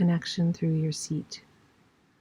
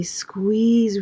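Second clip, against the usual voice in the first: ordinary talk, nothing marked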